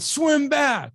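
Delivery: sharp, high shouted order; coach yelling. Retold or imitated. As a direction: bark it at the swimmer. Coach yelling at tired swimmer.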